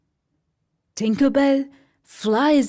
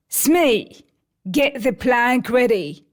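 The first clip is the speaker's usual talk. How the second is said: louder barked order; commanding Hook; 3 semitones higher, 4 dB louder than usual. Acted. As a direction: order the mate about.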